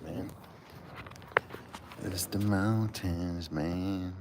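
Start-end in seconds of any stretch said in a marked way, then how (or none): 2.0-4.1 slow and quieter, "mountains" stretched long with a falling end; slow drawn out. Shown as a demonstration. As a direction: draw out "mountains" slowly as the air gets thin.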